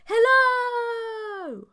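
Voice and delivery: very high, loud, long ringing hello; excited shout. A narrator's reading. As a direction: deafen him with joy. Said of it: "She was very excited".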